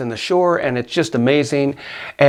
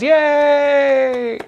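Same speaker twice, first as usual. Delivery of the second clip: high, drawn-out mock cheer; imitated crowd cheer; 11 semitones higher than usual. Onlookers applauding the rescued hiker, mock cheer. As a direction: cheer like a whole crowd, tongue in cheek. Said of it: "everybody applauds".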